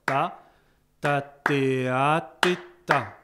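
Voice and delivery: loud chant with the tied note held long; sustained version. Shown as a demonstration. Teacher chanting the tied bar, holding the note. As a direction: hold the a as the tied note rings. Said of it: "un instrument qui peut faire durer".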